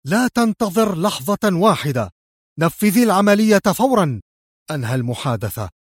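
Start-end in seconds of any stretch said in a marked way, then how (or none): 0.1-4.2 high, urgent order; fervent, raised-pitch instruction; agitated exclamation. A narrator's reading. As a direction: command immediate action with excitement. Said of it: "قبل أن يهتف في انفعال".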